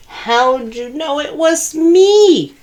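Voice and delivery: high, slow mock wail; wailing turkey. A narrator's reading. Turkey caught again by Sheep, slow high wail. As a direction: wail it out in dismay. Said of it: "wailed Turkey".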